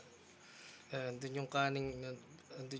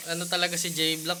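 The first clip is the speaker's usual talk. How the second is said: normal talking voice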